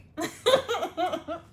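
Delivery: high bubbly giggle; a quick delighted laugh at herself. Real, not acted. Laughs at kiwi chick joke.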